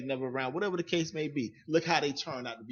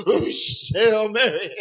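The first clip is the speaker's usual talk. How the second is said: high, loud, laughing Hail Mary; a hammed-up priest tossing cash; mock excited priest; 5 semitones higher, 6 dB louder, much slower than usual. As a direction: cry it out like a priest making it rain. Mock priest throwing money, laughing.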